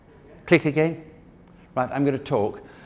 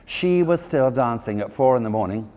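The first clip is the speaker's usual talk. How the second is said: ordinary delivery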